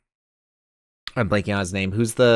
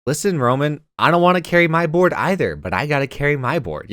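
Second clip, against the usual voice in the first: higher, firmer "Listen, Roman"; tough-love dad; 7 semitones higher than usual. Retold or imitated. Telling Roman he has to carry his own board, with a higher, firmer tough-love tone. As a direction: tell him straight but kindly. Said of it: "I would just be like".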